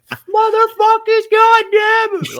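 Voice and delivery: very high, slow, strained cursing; mock outburst in a squeaky put-on voice; swearing in a cubicle as kids walk by; hammed-up cursing. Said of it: "and I'm like".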